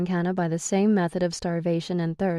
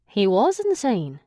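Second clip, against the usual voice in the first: raised, scornful Irish male voice; Declan, Irish brogue; 3 semitones higher, slower than usual. A narrator's reading. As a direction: sneer at her in a higher Irish lilt. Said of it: "Declan agrees".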